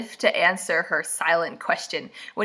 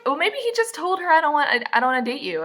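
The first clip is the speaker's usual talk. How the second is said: high mocking sarcasm; mock her, eyes rolling; sarcastic high voice; 8 semitones higher, faster than usual. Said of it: "It's like".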